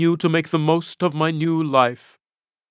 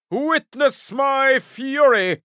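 high, slow, ringing war shout; battle cry; 8 semitones higher, slower than usual. Acted. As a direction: bellow it as you charge the enemy. Hero charging into battle.

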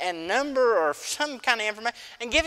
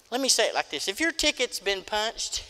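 ordinary talk

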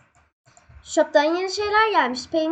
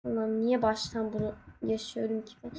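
normal talking voice

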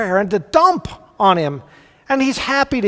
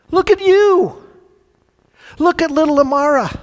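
much higher, bright with wonder; delighted; 8 semitones higher, 3 dB louder than usual. Acted. God voiced pointing to little Amara, delighted. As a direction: marvel at the child.

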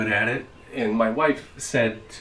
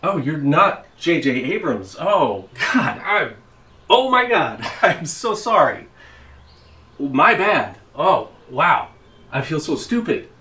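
embarrassed surprise; 5 semitones higher, 7 dB louder than usual. Pretend. Realises he has the wrong man.

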